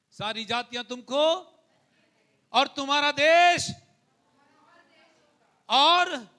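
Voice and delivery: rising pulpit fervour; fervent preaching. Acted. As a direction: preach it to the church with conviction. Proclaiming blessing on nations.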